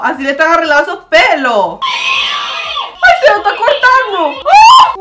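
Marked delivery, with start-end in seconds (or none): 1.8-5.0 very high, loud horrified cry; horrified shriek. Real, not acted. Vlogger watching bride's hair cut, horrified shriek. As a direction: shriek in horror.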